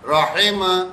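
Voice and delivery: "راحمہ" as "ra-hay-ma"; "راحمہ" with an "ay" middle vowel; wrong majhool vowel. Shown as a demonstration. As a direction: show the mistake in "راحمہ" with "hay". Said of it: "نہیں پڑھیں گے".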